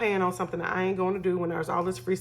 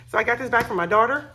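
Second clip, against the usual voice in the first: higher and louder, eager; excited reveal; 5 semitones higher, 6 dB louder, faster than usual. Real, not acted. Telling viewers about the daughter's gift, excited. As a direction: burst out with the news.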